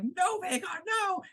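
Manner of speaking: high desperate shout of no; frantic yell. Retold or imitated. Aemond screaming at Vhagar to stop, high frantic yell. Aemond calling off his dragon.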